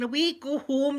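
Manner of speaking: half-hearted yell telling her grown son to go home; mock mum shout. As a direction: bark it like the fed-up mum. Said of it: "she was going".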